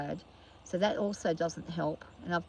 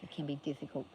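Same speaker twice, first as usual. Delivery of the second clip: ordinary delivery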